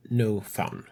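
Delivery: ordinary talk, nothing marked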